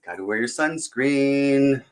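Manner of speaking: usual speaking voice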